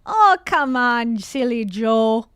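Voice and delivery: put-on slow, loud, drawn-out Canadian accent; mock Canadian accent. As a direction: ham up a Canadian accent, stretching the vowels. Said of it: "That's more West Coast".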